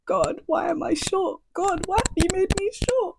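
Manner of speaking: high, soft hammed-up whimper; mock crying. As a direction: pretend to wail to God, over the top. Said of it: "Do you see me out here crying that I'm short?".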